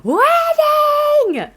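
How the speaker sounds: very high, sing-song squeal of glee; giddy high-pitched wedding squeal; excited squeal. Real, not acted. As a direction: shriek it like thrilling news. Squealing at marriage gossip again.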